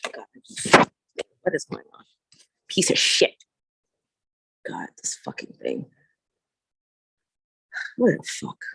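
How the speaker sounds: real exasperated whisper, mostly voiceless; frustrated whispered cursing. Real, not acted. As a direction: curse under your breath at a stubborn gadget. Cursing at a malfunctioning device.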